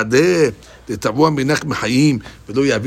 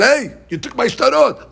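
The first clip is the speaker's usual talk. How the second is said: mock yell; 10 semitones higher than usual. Heir protesting the woman took his father's documents, mock yell. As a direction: cry out like the wronged owner, half acted. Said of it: "The guy screamed".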